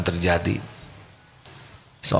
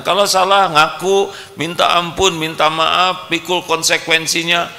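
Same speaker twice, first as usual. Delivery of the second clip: much higher, louder preaching voice; fiery preaching; 13 semitones higher, 5 dB louder than usual. Acted. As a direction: preach it with full conviction. Preaches at full force. (ceramah berapi-api dengan suara tinggi)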